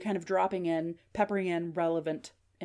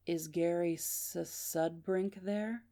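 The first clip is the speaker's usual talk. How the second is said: slow, soft, drawn-out question; very deliberate; 5 dB quieter, much slower than usual. Acted. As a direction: stretch each word out deliberately and quietly. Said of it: "that was a very deliberate".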